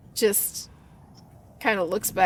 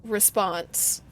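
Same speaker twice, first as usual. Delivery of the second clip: normal talking voice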